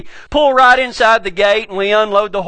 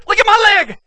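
alarmed cry; 10 semitones higher than usual. Retold or imitated. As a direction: show him the horror on your leg. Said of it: "she goes".

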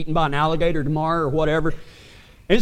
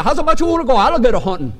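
put-on Cajun accent repeated; 5 semitones higher, much faster than usual. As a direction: repeat it in thick Cajun, amused. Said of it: "like, a piece of chicken in his mouth".

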